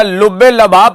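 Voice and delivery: लबाब with a instead of u after ल; mock the misreading la-BAAB in लबाब; imitated mispronunciation. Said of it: "पढ़ रहा है".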